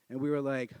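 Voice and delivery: ordinary delivery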